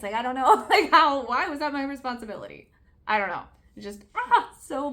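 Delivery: loud, high laughing exasperation; exasperated laugh. Real, not acted. Venting frustration about the blame.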